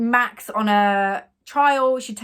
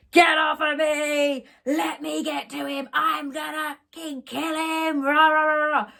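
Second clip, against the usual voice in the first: imitated aggressive yelling; 7 semitones higher than usual. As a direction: rage like a drunk bride, trailing into gibberish. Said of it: "getting really aggressive towards him".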